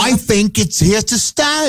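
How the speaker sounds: high, slow mock Cockney; awful Cockney. Co-host joking about foreign accent syndrome, exaggerated Cockney. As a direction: put on a hammed-up Cockney accent. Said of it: "You got the awful Cockney".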